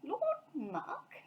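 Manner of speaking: mildly puzzled bear. A narrator's reading. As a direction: puzzle over the phrase. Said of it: "repeated Paddington".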